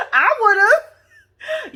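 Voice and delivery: high laughing cry; laughing hard. Real, not acted. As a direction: break up laughing mid-word. Laughing outburst.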